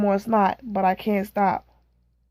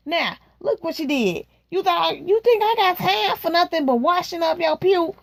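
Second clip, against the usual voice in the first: scolding mother; 6 semitones higher than usual. Acted. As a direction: fuss at the child for the mess. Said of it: "my mother says".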